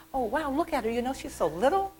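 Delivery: high, hushed amazement; retold wide-eyed wonder; surprised. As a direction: marvel under the breath. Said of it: "I was so surprised".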